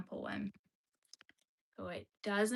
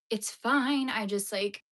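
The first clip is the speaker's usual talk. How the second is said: insistent; 7 dB louder, slower than usual. Real, not acted. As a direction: force it out brightly. Louder insistence.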